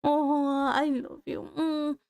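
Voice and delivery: ordinary talk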